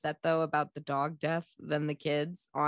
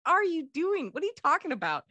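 high, disbelieving rant; mock outrage, pitched up; incredulous; 8 semitones higher than usual. Reacting to the movie scene, high incredulous outburst. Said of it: "And I'm like".

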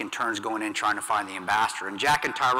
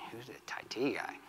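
softer, voiceless, puzzled; whispered question; 11 dB quieter than usual. Retold or imitated. Soldier asks himself in a bewildered whisper. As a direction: whisper it to yourself, searching memory. Confused inner thought after news.

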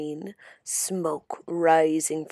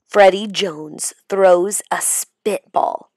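ordinary delivery

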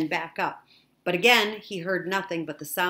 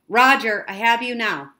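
raised, loud operator voice; loud and higher radio reply; radio acknowledgment; 5 semitones higher, 10 dB louder than usual. A narrator's reading. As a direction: call it firmly over the radio.

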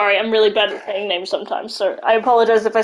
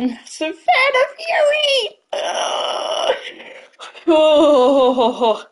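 tearful gushing; 3 semitones higher, much slower than usual. Real, not acted. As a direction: gush through happy tears. Fan gushing about idol.

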